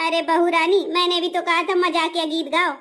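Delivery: ordinary talk, nothing marked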